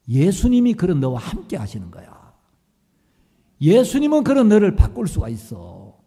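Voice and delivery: loud, impassioned sermon voice; fervent preaching pastor. Retold or imitated. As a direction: preach it with conviction.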